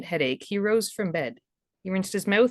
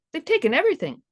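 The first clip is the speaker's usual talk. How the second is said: Ralph's voice; 10 semitones higher, 3 dB louder, faster than usual. Acted. Ralph tells lover of robbery.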